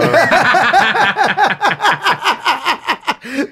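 long, loud, high cackle; uproarious laugh. Real, not acted. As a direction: explode into prolonged, high laughter. Group cracks up at dirty joke.

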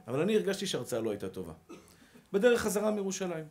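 ordinary delivery

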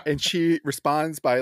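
ordinary talk, nothing marked

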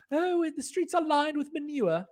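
very high, slow, mock refined British accent. Shown as a demonstration. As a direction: say it like a prim British lady, drawn out. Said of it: "if it's in a British accent".

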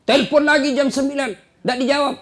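rising urgency. Acted. Preacher builds the scene loudly.

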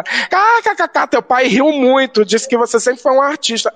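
high-pitched imitated amusement, slowing on the news; amused aunt. Aunt reporting dad's reaction, imitated amusement. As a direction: relay it as a tickled aunt. Aunt's laughing report, acted out.

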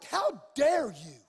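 slow, loud re-enacted rebuke; indignant outburst. Preacher confronting a doctor, retold indignant outburst. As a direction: draw it out with rising outrage. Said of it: "it rose up in me like".

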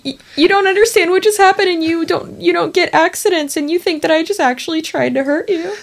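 high put-on dog voice, hurt and confused; voicing the dog. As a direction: speak as the wounded puppy, high up. Voicing the dog's view.

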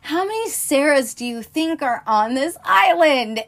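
Jeff Goldblum. Retold or imitated. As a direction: imitate an incredulous companion's comeback. Acts out Goldblum's exasperated retort.

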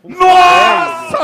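high, loud burst of amazement; surprised shout. Real, not acted. Watching a friend's throw, a loud amazed shout. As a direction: gasp it out loud. Reacting to a ring toss.

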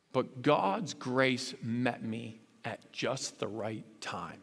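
drawn out, dropping to a near hush; slow hushed testimony. Shown as a demonstration. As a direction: let each word land slowly and softly. Dramatic slowed testimony quote.

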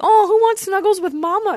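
high, cutesy imitation of a woman calling her cat; cooing cat owner. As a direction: coo to a pet in a mock mama voice. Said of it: "and she's like".

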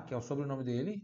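ordinary talk, nothing marked

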